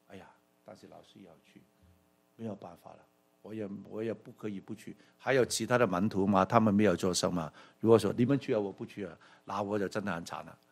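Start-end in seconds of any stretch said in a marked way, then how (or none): 0.1-5.0 much softer, quick, resigned aside; much softer grumbling of a trapped disciple; muttered inner grumble. Retold or imitated. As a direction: mutter it to yourself, fast. Preacher voices Thomas' inner grumble.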